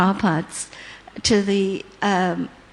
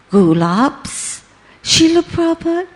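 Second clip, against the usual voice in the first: gulabs asked with a rising disbelieving tune, then higher and louder; startled question; 7 semitones higher, 3 dB louder than usual. Retold or imitated. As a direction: verbaas terug vragen: gulabs?. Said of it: "I stopped and I looked".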